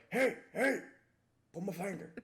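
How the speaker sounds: high-pitched old-man put-on. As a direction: call out like a joking old geezer. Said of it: "when I'm an old man going".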